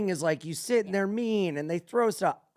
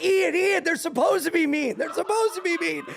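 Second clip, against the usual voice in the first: higher, louder, insistent retelling; imitated urgent correction; 8 semitones higher, 4 dB louder than usual. Friends reminding him the waiters act mean, urgently. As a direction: mimic friends frantically setting you straight.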